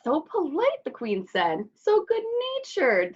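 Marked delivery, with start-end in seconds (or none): 0.0-0.8 queen's voice. Acted. The queen praises Griselda. 1.8-3.1 king's approving voice. Acted. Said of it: "the king approved".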